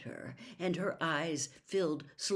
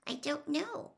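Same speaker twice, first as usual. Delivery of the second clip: miserable; 11 semitones higher, 4 dB quieter than usual. A narrator's reading. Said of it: "said Hermione miserably".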